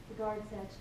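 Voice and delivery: ordinary talk, nothing marked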